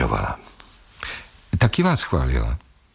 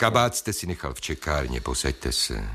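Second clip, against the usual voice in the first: soft, low and quiet; calming; 4 semitones lower, 6 dB quieter than usual. Acted. Psychologist coaxing the storming patient back to his chair, soft and low. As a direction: gently coax him back down.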